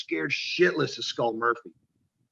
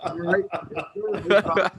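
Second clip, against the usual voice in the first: eager rookie; 4 semitones higher, 3 dB louder than usual. Retold or imitated. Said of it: "I'm like".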